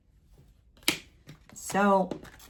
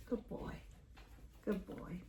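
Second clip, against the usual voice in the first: whispered praise; 18 dB quieter than usual. Real, not acted. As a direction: praise him in a hush. Praising dog, very soft.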